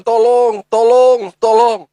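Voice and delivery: high, loud cries of tolong; acted child's cry for help; calling for help. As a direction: shout for help like a child stuck in a well. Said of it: "Dia harus berteriak-teriak minta pertolongan".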